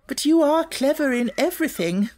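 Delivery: butter her up; approving. A narrator's reading. Headmistress changing her manner to flatter Sarah, approving. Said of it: "in her approving way".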